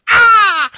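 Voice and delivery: high, loud squealed "Ah"; mock sneeze squeal. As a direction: imitate a ticklish sneeze. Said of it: "sounded like somebody tickled you, and then you sneezed".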